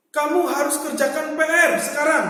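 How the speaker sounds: loud order with weight on "sekarang"; emphatic command. Shown as a demonstration. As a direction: give a firm instruction, pressing hard on "sekarang". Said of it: "Jadi, ada penekanan sekarang".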